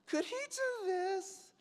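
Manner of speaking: very high, drawn-out squeal; strained, hammed-up high voice, slowly; mock plea. Actor pleading with the director, squeaky mock plea.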